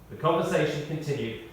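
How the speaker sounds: ordinary talk, nothing marked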